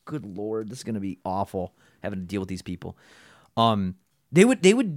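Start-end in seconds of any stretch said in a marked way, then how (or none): 0.1-3.0 low, muted groan of dread; softly weary inner thought; resigned dread. Retold or imitated. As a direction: say it under your breath, resigned. Dreading awful customers, retold thought.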